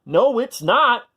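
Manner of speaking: high, loud, slow protest; cried out. A narrator's reading. Minosaur crying back at Apatosaur, loud. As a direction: cry it back slowly. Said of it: "cried Minosaur".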